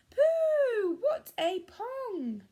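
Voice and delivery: high, loud squeal, slowly stretched out; shouting harp. Acted. Magic harp giving Jack away, high loud shout. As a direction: shriek it in disgust to wake the giant. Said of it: "the harp shouted".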